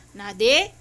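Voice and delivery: loud name with a rising tune; warning call. Real, not acted. Owner checking Nadi after telling it to stop, loud warning. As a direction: raise the name like a warning question.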